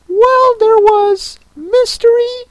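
high, slow, weepy turtle voice; very high tearful creature voice; Mock Turtle voice. A narrator's reading.

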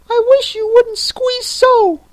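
high squeaky complaining dormouse; tiny creature, loud and squeaky; sleepy snoring dormouse. Acted.

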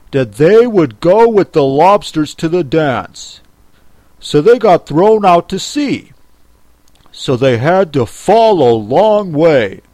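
loud, emphatic creature voice; Griffin. Acted. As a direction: explain it forcefully.

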